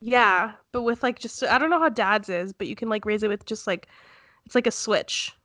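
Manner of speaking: ordinary delivery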